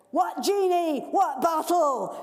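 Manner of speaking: put-on high, loud greedy child; demanding boy. Greedy boy demanding his present, high loud. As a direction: whine it like a spoilt boy. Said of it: "says the boy".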